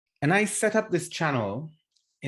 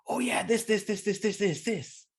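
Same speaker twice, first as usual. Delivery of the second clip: mock excited client, much higher; 8 semitones higher than usual. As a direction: gush like a client who just got excited. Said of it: "the client gets excited and goes".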